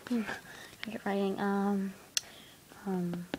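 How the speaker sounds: whisper. Pretend. Whispered in detention.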